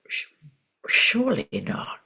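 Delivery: hushed, halting disbelief; retold stunned disbelief. Reacting to news her student has passed, hushed disbelief. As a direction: falter softly, unable to take it in.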